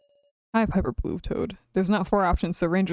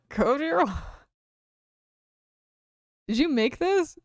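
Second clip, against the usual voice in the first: much higher, bright character voice; eager character voice; 7 semitones higher than usual. Acted. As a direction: pitch up into the character.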